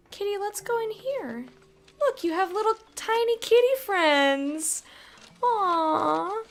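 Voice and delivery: ordinary delivery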